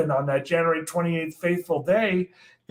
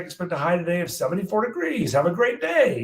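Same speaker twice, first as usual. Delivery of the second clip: quick, bright-voiced weather intro; forecast read; 4 semitones higher, faster than usual. Young meteorologist recording his morning forecast, brisk announcer read. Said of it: "and we recorded".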